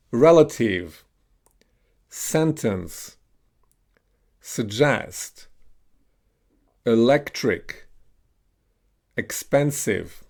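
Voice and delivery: each word alone with the E of "ten", as in "sentence"; "suggest" with short E, falling tune; short E modelled. Shown as a demonstration. Teacher has learners repeat short E words, careful drill delivery. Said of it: "pronounce the e as in ten".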